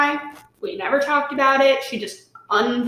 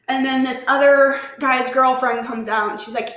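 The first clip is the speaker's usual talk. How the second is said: normal talking voice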